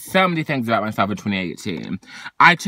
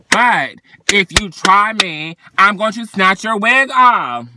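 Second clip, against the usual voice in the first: higher, louder, slow and menacing; sassy threat; 6 semitones higher, 5 dB louder, slower than usual. Real, not acted. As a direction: warn them off with attitude. Warning anyone who tries her.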